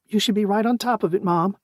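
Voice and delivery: bright, high-pitched AI companion. A narrator's reading. AI Fitzy speaks.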